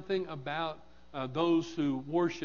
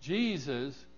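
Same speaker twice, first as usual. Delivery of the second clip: normal talking voice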